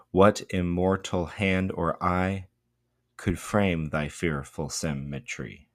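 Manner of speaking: slow, beat-by-beat "What immortal hand or eye could frame thy fearful symmetry"; overemphasized meter. Shown as a demonstration. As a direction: hammer each stressed syllable, "eye" and "frame" included, pausing after "eye". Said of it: "overemphasis on the meter".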